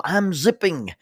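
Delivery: high, loud warning call; imitated yell. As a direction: call it out before jumping off. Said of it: "the person's supposed to yell".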